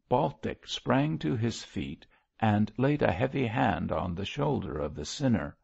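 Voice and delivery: ordinary delivery, nothing marked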